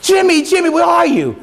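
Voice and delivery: high, full shout of the name; desperate father calling into the fire; top of voice yell. Retold or imitated. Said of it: "yells at the top of his voice".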